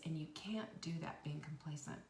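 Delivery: hushed, urgent whisper; soft whisper pressing the point home. Real, not acted. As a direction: lean in and whisper the warning. Intimate urging to listeners.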